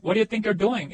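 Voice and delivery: connected, fluent question with YOU'RE contracted; joined-up natural version. Shown as a demonstration. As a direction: run the words together at normal speed. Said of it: "합쳐보세요".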